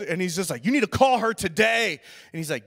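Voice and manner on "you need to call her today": loud, higher imitated roommate demand; mock-angry insistence; stern order. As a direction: lay down the law, exasperated.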